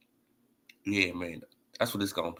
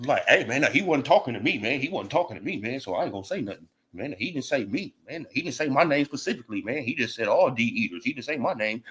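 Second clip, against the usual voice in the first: mock timid excuse; 4 semitones lower than usual. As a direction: make him sound small and cowardly as he excuses himself. Mocking a timid excuse-maker.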